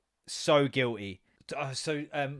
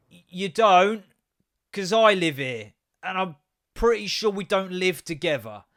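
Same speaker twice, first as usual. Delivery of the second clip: loud retort; 3 semitones higher, 8 dB louder, slower than usual. Retold or imitated. Telling stranger he doesn't live there.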